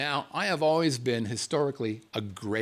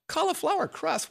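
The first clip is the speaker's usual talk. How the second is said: much higher, amazed question; incredulous disbelief. Retold or imitated. Telling listeners about keto pizza, high incredulous squeal. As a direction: act stunned that cauliflower crust exists. Mock disbelief at cauliflower crust.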